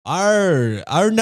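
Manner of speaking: bad Australian accent, arr drawn out. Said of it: "an Australian accent?".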